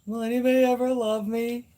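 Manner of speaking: mock needy wail; voicing Astro. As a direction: plead for love as the pet would. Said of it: "No, Astro".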